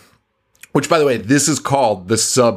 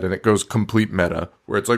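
usual speaking voice

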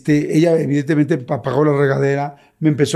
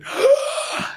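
acted gasp of shock; terrified gasp; 3 dB quieter than usual. Sees a figure behind the fogged glass, mock terrified gasp.

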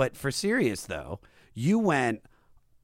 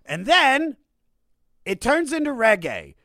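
mock dramatic; 11 semitones higher, 4 dB louder than usual. Building up to hated reggae part.

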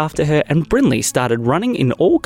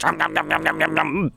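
chomping noises; 3 semitones higher, 4 dB quieter than usual. Acted. Gopher biting the kids.

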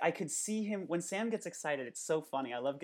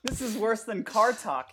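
ordinary delivery

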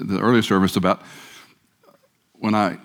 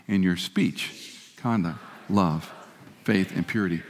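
much softer and very slow, drawn out; soft slow recitation; 3 semitones lower, 7 dB quieter, slower than usual. Shown as a demonstration. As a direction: let it fall to a hushed, slow close. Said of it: "One last time".

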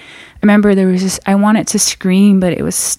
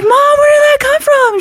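shocked disbelief; 4 dB louder than usual. Retold or imitated. Shocked at mom's gun.

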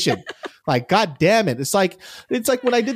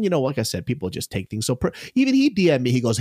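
ordinary talk

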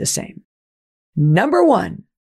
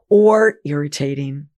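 usual speaking voice

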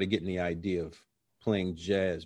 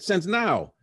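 cocky reply; 11 semitones higher, 5 dB louder than usual. Retold or imitated. His cocky answer.

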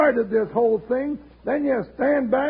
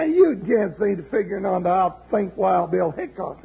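ordinary talk

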